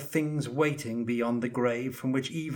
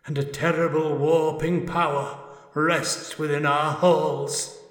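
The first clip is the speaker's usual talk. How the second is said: high, loud, otherworldly ghost; an anguished spirit calling from beyond; ghostly spirit voice; 7 semitones higher, 7 dB louder than usual. Acted. Ghost speaking inside his head.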